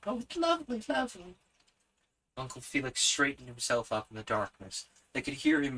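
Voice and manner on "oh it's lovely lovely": put-on high, delighted girl; delighted child voice. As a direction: gush with delight.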